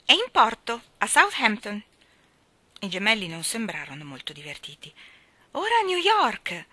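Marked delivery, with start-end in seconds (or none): 0.0-1.8 higher, louder, cheery announcement; joyful. A narrator's reading. Girl pretending the goose sails abroad, joyful. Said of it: "disse gioiosamente". 5.5-6.7 very high, loud, cheery; gleeful announcement. A narrator's reading. Girl playing ships for the crying twins, gleeful announcement.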